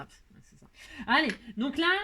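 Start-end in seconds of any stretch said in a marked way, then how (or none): 0.0-0.7 whispered to herself; whispering. Real, not acted. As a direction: whisper it under your breath. Muttered to herself.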